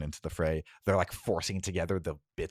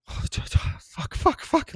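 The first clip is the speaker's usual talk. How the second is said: panicked cursing; 4 dB louder, much slower than usual. Acted. Lily panics seeing you down.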